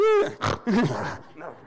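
loud hammed-up sob noise; mock crying. Preacher imitates big tough guy breaking down, mock crying. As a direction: imitate a tough man blubbering. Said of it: "he's, like, crying".

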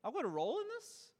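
high, astonished question; mock wide-eyed disbelief; sudden delighted surprise at the thought; amazed. Real, not acted. Amazed discovery, voiced surprise.

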